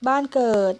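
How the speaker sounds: usual speaking voice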